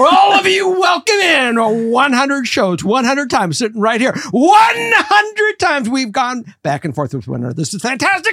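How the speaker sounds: high, loud screaming hype. Acted. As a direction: roar it out like a game show host. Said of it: "you were really screaming".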